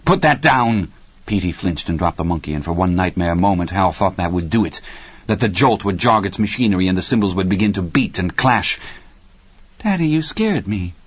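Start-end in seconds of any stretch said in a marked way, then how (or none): 0.1-0.9 higher, startled father's order; sharp command. A narrator's reading. Hal to Petey holding the monkey, sharp command. 9.8-10.9 put-on high-pitched little boy's voice; scared child. A narrator's reading. Petey to his father after a fright, scared child.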